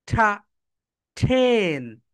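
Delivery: ordinary talk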